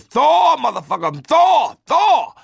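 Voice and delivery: high, loud screaming "Thor"; war cry. Retold or imitated. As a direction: scream it with every hammer blow. Wife swings hammer shouting.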